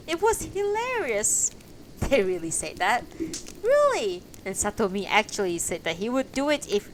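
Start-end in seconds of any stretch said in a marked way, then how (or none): 0.1-1.5 amused. Acted. Haraguchi laughing at story. 3.6-4.2 high, loud disbelieving Really; surprised. Acted. Hirota surprised.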